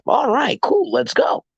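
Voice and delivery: mock-excited client saying yes; happy client. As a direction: voice the buyer cheerfully agreeing. Client voiced agreeing in story.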